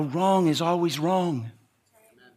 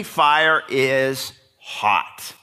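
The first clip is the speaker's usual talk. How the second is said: slow emphasis; much slower than usual. Real, not acted. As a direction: fire is hot, very slowly. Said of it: "slow emphatic claim".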